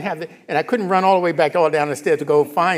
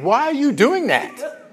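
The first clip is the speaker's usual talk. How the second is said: higher, slower, amused bewildered ask; a baffled laughing why; puzzled question; 10 semitones higher, 3 dB louder, slower than usual. Retold or imitated. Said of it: "Instead of saying".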